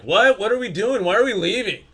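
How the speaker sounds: high, loud, baffled questions; overly loud. Retold or imitated. Frankie loudly asking why the crew must leave, imitated. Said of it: "he was a little bit overly loud".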